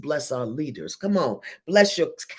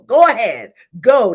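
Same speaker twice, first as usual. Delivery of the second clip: loud, high call to the congregation; rousing shout; 5 semitones higher, 12 dB louder than usual. Real, not acted. Preacher urging the congregation to bless, loud and high. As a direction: shout them on to send it.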